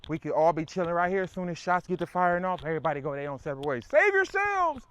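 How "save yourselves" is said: high, loud cry to scatter; mock panicked shout. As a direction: yell it out like shots just went off. Mock panic when shots fire.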